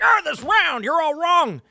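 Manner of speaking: put-on high, loud old-man rant; hammed-up crotchety old man shouting; ranting old guy. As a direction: cry out like a cranky old man. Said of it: "I'm gonna be the old guy sitting there".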